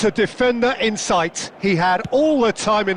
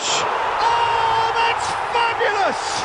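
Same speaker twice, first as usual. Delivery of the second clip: excited goal call; 14 semitones higher, much slower than usual. Real, not acted. Commentator hailing a fabulous goal, excited high shout. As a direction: lose yourself in the goal.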